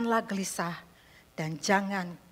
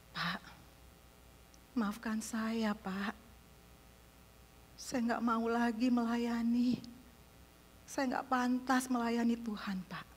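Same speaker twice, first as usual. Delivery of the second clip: softer, tearful confession; tearful plea; 3 semitones higher, 6 dB quieter, slower than usual. Retold or imitated. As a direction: say it quietly as if close to crying. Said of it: "mulai menangis". (pengakuan lirih sambil menahan tangis)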